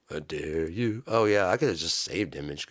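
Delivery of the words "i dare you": low, soft dare; low menacing dare. Acted. Singer challenging his band, low taunt. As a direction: drop the voice and dare them quietly.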